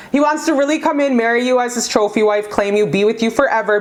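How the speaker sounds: ordinary talk, nothing marked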